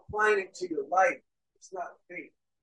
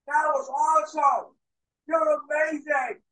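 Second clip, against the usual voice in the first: raised, drawn-out crowd cheering; screaming fans; 5 semitones higher than usual. Acted. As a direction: imitate fans hollering praise, without full volume. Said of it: "Everyone's screaming".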